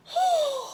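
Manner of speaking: a sharp, high gasp of shock; quick startled intake of breath. Acted. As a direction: gasp as if you just saw her faint. Missus Twit faints, reader gasps.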